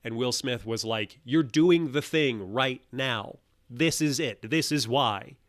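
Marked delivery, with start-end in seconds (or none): 1.2-5.4 loud, slow, hammered-out telling-off; forceful, drawn-out rebuke; emphatic scolding. Retold or imitated. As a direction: imitate a coach driving the point home. Acts out Will Smith scolding the man.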